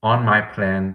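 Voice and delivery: ordinary talk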